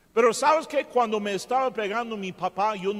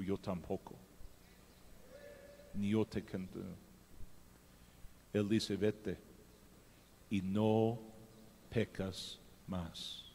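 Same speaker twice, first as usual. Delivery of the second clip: much softer and lower, gentle as Jesus; tender forgiveness; 10 semitones lower, 11 dB quieter than usual. Acted. Jesus speaking to the accused woman, soft and tender.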